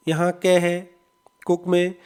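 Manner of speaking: ordinary talk, nothing marked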